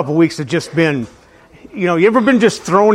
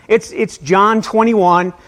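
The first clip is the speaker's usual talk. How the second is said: normal talking voice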